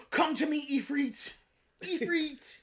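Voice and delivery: high, loud, mock-grand British summon; overdone British. Friend mocks the actor's British accent, hammed-up British. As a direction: ham up a posh British call to Ifrit. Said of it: "too much British".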